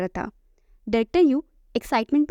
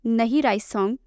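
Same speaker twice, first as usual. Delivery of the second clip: ordinary delivery, nothing marked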